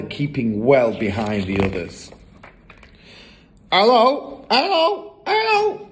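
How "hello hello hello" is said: very high parrot squawks; parrot calling. A narrator's reading. Hidden parrot calls from the room, squawking. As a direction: climb higher with each call. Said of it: "came the voice again".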